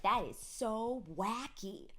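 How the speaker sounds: put-on drawn-out kid voice; imitating a child. Mother imitating her kids, slow kid verdict.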